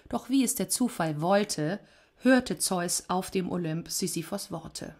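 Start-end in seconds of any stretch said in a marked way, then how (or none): none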